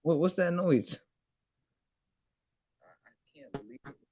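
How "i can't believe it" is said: stunned whisper. Real, not acted. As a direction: breathe it out in disbelief.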